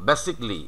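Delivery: "basically" in three syllables, BASIC-lee; "basically" as basik-li, falling at the end; correct "basically". Shown as a demonstration. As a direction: say "basically" with the middle "ca" dropped. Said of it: "আমাদের উচ্চারণ দাঁড়াচ্ছে এরকম".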